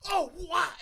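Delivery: high, loud "oh, what?"; retold startled shout. Telling a friend about the rat, loud startled shout. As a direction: yelp it out in shock.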